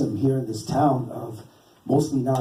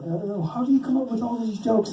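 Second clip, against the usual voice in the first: higher-pitched mock questioner; lightly voiced curious fan, pitched up; imitating curious customers; 9 semitones higher than usual. Booth customers asking the cartoonist about his jokes, imitated curiosity.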